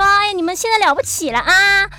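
put-on sarcastic nagging, like a mother who feels her grown kids ignore her; sarcastic parent. Parent scolding grown children for no longer listening, in a sarcastic mock voice. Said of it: "就觉得说".

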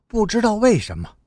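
plain reading voice

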